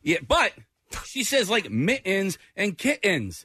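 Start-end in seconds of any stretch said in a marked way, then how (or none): 1.7-3.4 "mittens and kittens" with clipped glottal T's, slowed; slow Jersey "mittens and kittens" with swallowed T's. Retold or imitated. Husband imitating his Jersey wife's mittens. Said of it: "that whole New Jersey thing".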